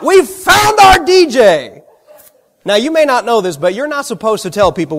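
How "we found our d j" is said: high, loud, drawn-out announcement; retold excited shout. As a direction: shout it out like a delighted director. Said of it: "And then he shouted out".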